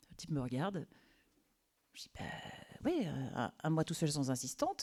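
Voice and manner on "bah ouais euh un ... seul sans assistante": soft, breathy sympathy; hushed comfort. Retold or imitated. Temp quietly comforting her boss. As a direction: say it gently, almost under the breath. She soothes the stressed boss.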